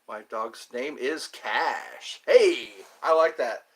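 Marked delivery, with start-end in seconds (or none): 0.1-2.2 slow deadpan. Pretend. Silly cue card line.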